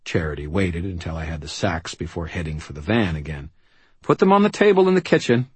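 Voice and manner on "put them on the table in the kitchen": bossy mother voice. Acted. Charity gives orders.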